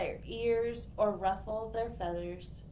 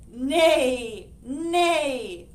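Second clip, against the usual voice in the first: loud neighing nay; horse neigh; 2 semitones higher, 9 dB louder than usual. Acted.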